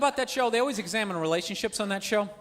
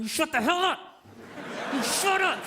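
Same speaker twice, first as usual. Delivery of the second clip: mock-angry order, a little raised; bullying boyfriend; 3 semitones higher than usual. Boyfriend holding his girl by the neck, mock-angry command. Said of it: "this dude has got his girl".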